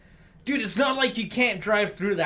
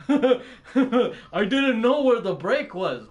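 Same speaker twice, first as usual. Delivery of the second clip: mock trucker apology; 3 semitones higher, 4 dB louder than usual. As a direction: play the trucker sheepishly apologizing. Trucker quoted again.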